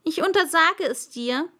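high, loud royal order; pompous king's decree; commanding king. Acted. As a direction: forbid it with full authority. King forbids yawning.

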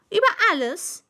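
high, loud royal answer; pompous king stating it matter-of-factly; plain-spoken king. Acted. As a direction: answer simply and grandly. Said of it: "antwortete schlichtweg der König".

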